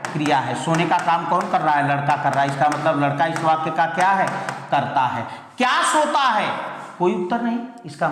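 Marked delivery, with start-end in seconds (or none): none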